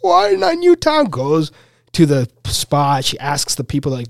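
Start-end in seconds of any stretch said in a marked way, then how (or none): 0.0-1.1 very high, slow mock wail; mock crying. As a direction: imitate a woman wailing in tears. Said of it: "this blonde lady's just crying".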